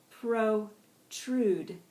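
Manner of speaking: "Protrude" broken into two long, separate syllables; slowed-down pronunciation. Shown as a demonstration. Teacher modelling "Protrude" for the class, slowly, syllable by syllable. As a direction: split "Protrude" slowly into pro and trude. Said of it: "with varying degrees of speed".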